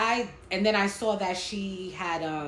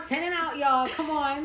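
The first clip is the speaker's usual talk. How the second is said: excited hype; 8 semitones higher, 4 dB louder than usual. Real, not acted. Hyping listeners to share.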